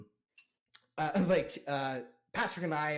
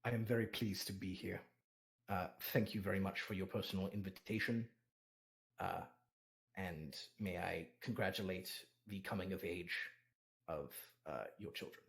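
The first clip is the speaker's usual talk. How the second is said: Patrick's low, soft, stiff formal voice; 8 semitones lower, 9 dB quieter than usual. Acted. Patrick thanking the host lord in a low, stiff, formal voice. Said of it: "and he goes".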